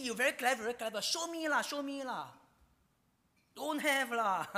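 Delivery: high, loud sarcastic jeering. Acted. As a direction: goad them with mock praise. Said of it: "That was a sarcastic remark".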